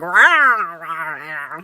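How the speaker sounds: a gruff, growly rumble for the sleeve, loud at the start and fading at the end; a lightly voiced growling belly; stomach growling voice. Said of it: "And my sleeve was like".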